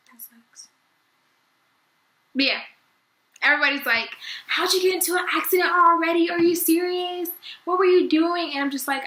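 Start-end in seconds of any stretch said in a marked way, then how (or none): 0.1-0.7 barely voiced, soft whisper; deflated whisper. Whispered disappointment at not finding the boy. 4.5-8.5 higher, louder disbelieving questions; incredulous family. Retold or imitated. Relatives loudly demanding how the accident happened.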